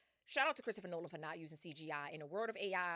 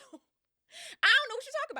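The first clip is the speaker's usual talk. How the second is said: much higher, loud, sing-song innocence; high fake-innocent voice; 13 dB louder than usual. Circe playing dumb to Odysseus, high and innocent. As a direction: play dumb in a high put-on voice. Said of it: "Seriously, like".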